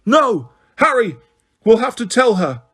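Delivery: a voice breaking as she shouts; cracked shout. A narrator's reading. Hermione to Harry, shouting in a cracked voice. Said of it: "shouted Hermione in a cracked voice".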